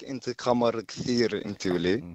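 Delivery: compliment spoken in Arabic. Shown as a demonstration. Caller asked to flatter Beck in Arabic, playful Arabic compliment. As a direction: switch to Arabic for a flirty line. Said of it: "in Arabic".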